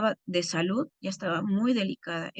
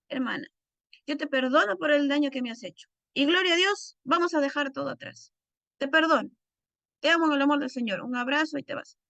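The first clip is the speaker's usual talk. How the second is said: gushing mock forgiveness; 6 semitones higher than usual. Shown as a demonstration. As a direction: ham up a breezy forgive-and-forget. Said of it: "Pero no vas a decirle".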